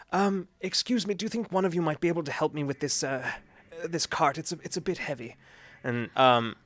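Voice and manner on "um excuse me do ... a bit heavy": lightly raised, polite professor voice; a hint of a timid old professor asking for help.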